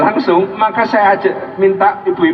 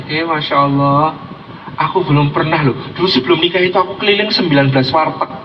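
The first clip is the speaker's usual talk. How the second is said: sweet-talking husband; 5 semitones lower than usual. Acted. Voicing husband flattering his wife's cooking.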